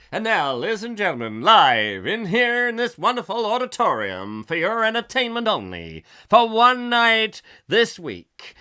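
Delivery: mock compere introducing the family act, booming; showbiz announcer. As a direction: hype up the crowd like a variety-show host.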